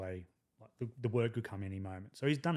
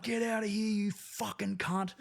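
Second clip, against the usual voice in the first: retold blackout rage; 9 semitones higher, slower than usual. Soldier hauling liar out of vehicle, retold rage. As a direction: drag out the insult in high-pitched retold fury. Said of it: "I just blacked out anger".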